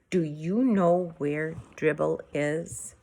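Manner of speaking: slow calm question; calmly. A narrator's reading. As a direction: ask it slow and steady, holding it in. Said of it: "I asked him calmly".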